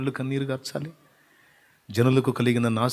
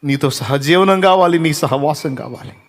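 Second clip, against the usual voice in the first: louder and higher cry, stretching కావాలి; loud plea; 3 semitones higher, 8 dB louder than usual. Acted. Believer crying out loudly to the Lord for fellowship.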